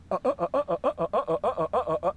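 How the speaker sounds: fast grunts from the throat; rapid throat grunts. Shown as a demonstration. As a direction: grunt out quick staccato uhs. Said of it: "what I'm gonna be saying into it".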